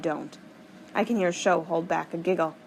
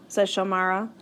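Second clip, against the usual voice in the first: plain reading voice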